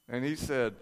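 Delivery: usual speaking voice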